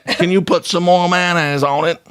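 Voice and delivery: high, hammed-up British accent on crikey; a bad British accent that slips toward Australian; British impression. Shown as a demonstration. Showing off a British impression to the co-hosts, hammed-up British accent. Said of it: "an Australian man in the U K".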